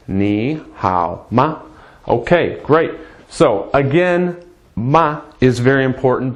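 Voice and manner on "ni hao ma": low, slow model pronunciation; slow repeat-after-me. Shown as a demonstration. Teacher leading learners aloud, slow deliberate demonstration. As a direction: space out "Ni hao ma" for learners to follow. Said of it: "Say that with me".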